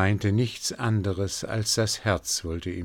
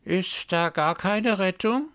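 much higher, mocking raven chatter with a laugh; a gossiping bird sneering; cawing raven voice; 12 semitones higher than usual. A narrator's reading. First raven to the others, high mocking caw.

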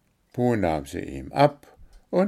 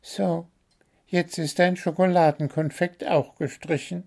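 high bird voice with a mock grumble; pretend-stern little bird; little bird growling; 9 semitones higher than usual. A narrator's reading. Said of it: "knurrte der kleine Vogel".

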